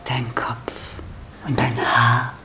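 low, hushed ritual voice; reverent worship. Shown as a demonstration. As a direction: offer each phrase gently, pausing between. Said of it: "und jetzt verehrt die Frau den Mann". (andächtig, leise und tief)

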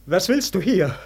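ordinary delivery

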